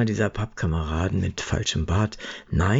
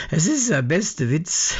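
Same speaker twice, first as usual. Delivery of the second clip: amused disbelief; 9 semitones higher than usual. Acted. Herbie laughing at the claim.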